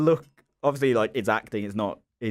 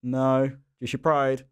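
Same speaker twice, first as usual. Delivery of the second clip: higher, drawn-out check-in with replies; imitated director calling; 5 semitones higher, much slower than usual. Director checking on actor lying on the ground, imitated calling exchange. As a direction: act out a call across to a fallen actor. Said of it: "I heard the director be like".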